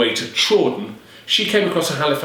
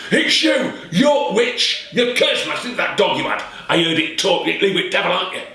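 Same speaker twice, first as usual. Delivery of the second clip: shouting accusation; 7 semitones higher than usual. Acted. Peddler accuses Alison.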